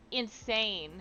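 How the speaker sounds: ordinary delivery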